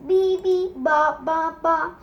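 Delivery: put-on babbling baby. A narrator's reading. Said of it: "cried Molly".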